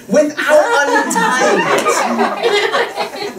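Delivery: drawn-out high tease. Acted. Magician teasing the trick.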